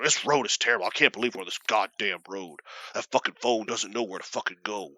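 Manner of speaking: low-pitched, grumbling rant; complaining dad impression. Dad griping the whole drive, mock grumbling. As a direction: imitate a father griping on and on. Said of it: "got to yell about how bad the road is".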